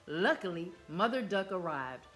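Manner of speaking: plain reading voice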